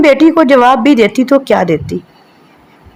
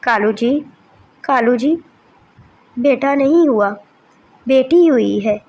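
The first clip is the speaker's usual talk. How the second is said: softer, hesitant mother crow; timid wife; 6 dB quieter than usual. Acted. Wife softly tells Kalu they had a daughter. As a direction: break the news gently.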